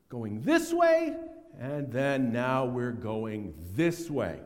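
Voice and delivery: slow, this way then that way; slow contrast. Shown as a demonstration. Preacher showing the turn of repentance, slow and deliberate. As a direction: draw out each direction like pointing. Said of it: "demonstrating the turn".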